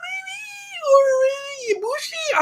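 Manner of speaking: hesitant, drawn-out high-pitched hedging; unsure guess. Real, not acted. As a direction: voice climbing into a very high squeak. Hesitant, squeaky guessing at possible winners.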